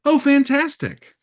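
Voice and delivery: mock delight.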